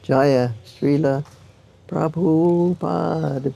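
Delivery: very slow, stretched-out reading of a slogan; drawn-out acclamation. Retold or imitated. Reading a billboard praising his teacher, drawn-out acclamation. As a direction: draw out each name, lifting the last.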